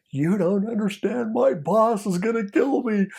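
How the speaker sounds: high pleading wail, slow and strained; imitating crying. Undercover pleading with the trafficker, high wailing mock sob. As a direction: beg through mock tears. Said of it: "he's literally crying, not faking it".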